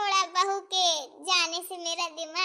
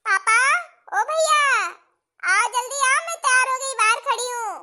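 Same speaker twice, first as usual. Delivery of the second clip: loud, high call to family outside; 4 semitones higher, 6 dB louder than usual. Acted. Daughter calling father and brother from outside, loud. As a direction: call them over loudly.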